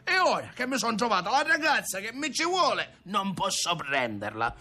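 very high-pitched frustrated rant; exasperated outcry. A narrator's reading. Grandson protesting he cannot marry, crying out in frustration. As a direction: wail the grievance high, stamping mad. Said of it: "cominciò a gridare Ntoni".

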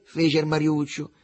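usual speaking voice

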